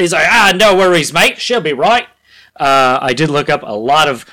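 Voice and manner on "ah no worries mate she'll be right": put-on breezy, louder Australian accent; an easygoing Aussie shrugging it off. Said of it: "I wanna make him Australian".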